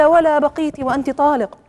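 loud, high-pitched dismissal; acted angry retort. Husband divorces the last remaining wife, acted angry retort.

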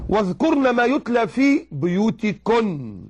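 chanted verse, unhurried; Quran recitation. Acted. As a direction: intone the verse with reverence.

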